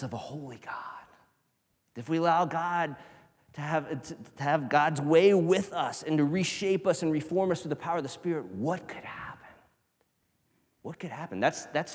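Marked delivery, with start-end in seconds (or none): 0.0-0.7 whisper. Acted. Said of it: "contrast with bored churchgoer". 8.5-9.5 whisper. Acted.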